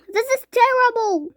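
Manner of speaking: high, loud wail; despairing mother wailing. A narrator's reading. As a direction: moan it in despair. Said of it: "wailed Missus T V".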